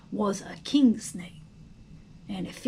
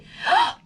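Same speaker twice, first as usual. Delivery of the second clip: sharp gasp; 6 dB louder than usual. Acted. Harriet attacks the snake.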